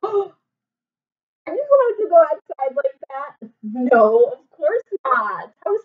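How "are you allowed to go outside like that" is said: high, slow, loud mock shock; shocked gasp. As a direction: gasp in exaggerated surprise. Gasping shocked question to children.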